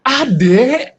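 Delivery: mock angry parent's high, loud call. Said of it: "kalau kita lagi marah, intonasinya pasti tinggi".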